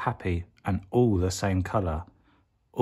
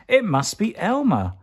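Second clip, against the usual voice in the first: realisation; 9 semitones higher, 3 dB louder than usual. A narrator's reading. Elephants realising.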